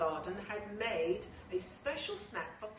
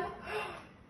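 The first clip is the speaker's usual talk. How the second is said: sharp gasp; 11 semitones higher than usual. Acted. Gasp before blast off.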